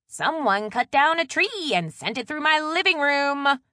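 high, loud, pointed grievance; loud complaint. A narrator's reading. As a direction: complain loudly so the culprit overhears. Pointedly loud complaint for husband's benefit.

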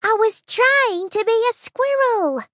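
high, clearly spoken little bee. Acted. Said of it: "Honey repeated, clearly this time".

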